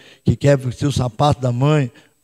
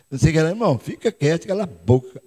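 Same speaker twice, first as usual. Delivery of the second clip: ordinary talk, nothing marked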